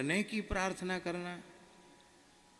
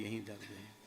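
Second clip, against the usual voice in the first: meek quiet reply; 5 semitones lower, 8 dB quieter than usual. Retold or imitated. Disciple answers guru meekly.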